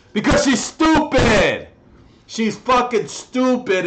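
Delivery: yelled high and loud; furious shout; angry yell. Real, not acted. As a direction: explode at her. Ranting about neighbour.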